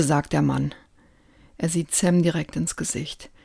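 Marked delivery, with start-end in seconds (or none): none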